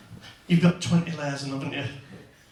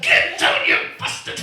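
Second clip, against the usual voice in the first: loud, strained yell at the stuck zip; straining yell; 8 dB louder than usual. Pretend. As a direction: yell at the zip while struggling. Fighting the tent zip.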